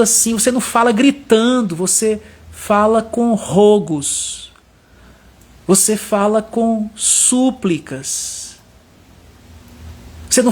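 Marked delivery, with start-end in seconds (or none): 1.8-8.6 drawn-out pleading words; pleading, slow and weak. Shown as a demonstration. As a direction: let the strength drain from the voice, beg slowly. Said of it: "perdendo aquela força na voz".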